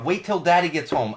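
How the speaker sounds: mock warning. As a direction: warn the child ominously.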